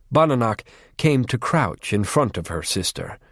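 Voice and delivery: usual speaking voice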